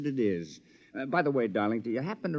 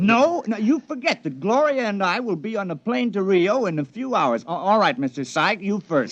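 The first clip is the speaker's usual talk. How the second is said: louder and higher, triumphant menace; loud threat; 5 semitones higher, 6 dB louder than usual. Acted. Robot double with a gun, telling the men who dies first, loud and menacing. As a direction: bark it out like an executioner choosing. Gunman threatens to kill three.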